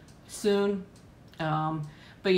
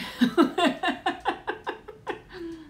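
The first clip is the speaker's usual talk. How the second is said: long high laugh falling away; high giggle; 12 semitones higher than usual. Real, not acted. Laughing at a viewer's late return, high giggle. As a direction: let a giddy laugh tumble down.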